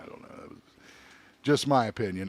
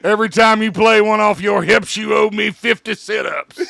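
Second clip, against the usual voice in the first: loud, raspy barking manager; gruff coach shout; 5 semitones higher, 6 dB louder than usual. Retold or imitated. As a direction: bellow at a lazy player. Quoting the coach from Major League.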